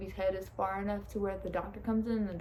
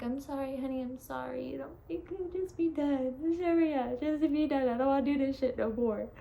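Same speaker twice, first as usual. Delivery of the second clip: nurse's sympathy; 5 semitones higher than usual. Retold or imitated. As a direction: soothe and urge to hurry. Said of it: "she's like".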